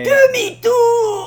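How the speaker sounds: high, loud mock yell of the line; film quote shout. Two podcast hosts quoting Harpoon, shouted high. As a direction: belt it like the movie scene.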